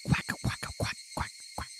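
whispered quacking cicada call; bad cicada impression; imitated cicada chirp. Shown as a demonstration. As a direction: fake the insect chirp under your breath. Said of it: "I can't do a good, uh, cicada".

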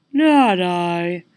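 cat voice meowing the words. Acted. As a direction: meow it out like a cat. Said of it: "meowed the crazy orange cat".

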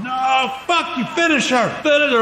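high, strained mock crowd yell; booing crowd. Crowd demands the fighter be finished, high mock screaming. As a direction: jeer like an angry mob without real volume. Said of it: "the crowd's hissing and booing".

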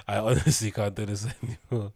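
real laughter breaking up the words; laughing. Real, not acted. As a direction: read it while cracking up. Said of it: "I shouldn't be laughing".

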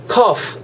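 "cough" high, ending in an audible F. Shown as a demonstration. Teacher demonstrating the F sound, clear "cough". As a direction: say "cough" with the final F released. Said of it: "F 발음 내주시면 돼요".

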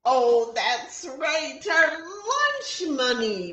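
put-on high, loud children's call; high chorus shout; kids shouting. A narrator's reading. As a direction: shout it out together. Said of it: "we shout".

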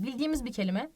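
ordinary talk

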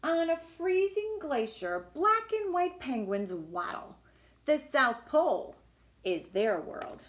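lively, sing-song picture book reading; reading to children. Acted. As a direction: read it like a storybook for little kids. Reads picture book aloud.

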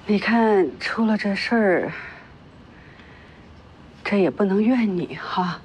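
slow, low, careful reassurance; low, measured sympathy; gentle consoling. Acted. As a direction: break it to her slowly and kindly. Older woman consoles the wronged girl.

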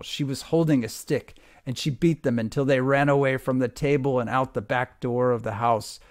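ordinary delivery, nothing marked

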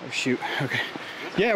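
ordinary talk

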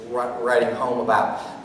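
ordinary talk

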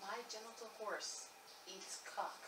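whispering. Acted. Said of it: "I have to whisper it to 'em very quietly".

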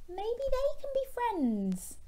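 very high, slow, sing-song; hopeful suggestion. Pretend. Storyteller to children about new friends, high hopeful lilt. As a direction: float the idea up high and slowly.

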